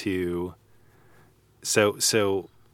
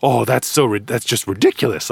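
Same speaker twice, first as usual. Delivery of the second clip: fuming; 3 semitones higher, 3 dB louder than usual. Retold or imitated. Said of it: "still fuming".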